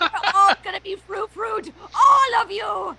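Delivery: villain threat. Acted.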